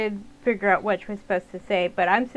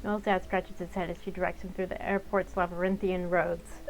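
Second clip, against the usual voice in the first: usual speaking voice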